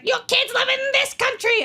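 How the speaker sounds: high mock-whiny Arya voice. Friend voicing Arya high and mocking. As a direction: squeak it out as a petty teen.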